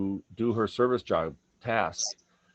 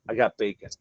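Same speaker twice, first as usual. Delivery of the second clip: ordinary delivery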